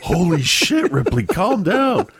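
high-pitched startled parent; hammed-up alarm, pleading with an overexcited child; mock alarm. Acts alarmed parent calming possessed daughter.

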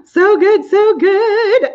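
high, bright excited gush; excited squeal. Real, not acted. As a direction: squeal it with delight. Excited gush over a comment.

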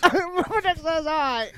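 high, slow goofy squeak; silly high voice. Retold or imitated. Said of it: "he'll do this one".

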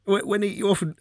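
ordinary talk

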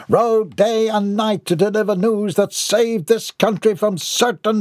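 high-pitched pompous oratory; grandiose Mortimer. A narrator's reading. Mortimer pleads his cause at the counter, grandiose. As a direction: declaim as if the country depends on it.

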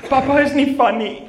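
high, loud, laughing mock sob of a little girl; imitating crying daughter. Father mimicking his crying daughter wishing for her mother. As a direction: wail it like a tearful child. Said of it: "she starts crying and she says".

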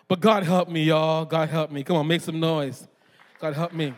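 ordinary talk, nothing marked

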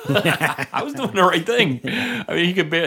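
laughing, high and slow. Real, not acted. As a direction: laugh through your defense. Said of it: "you became the dick".

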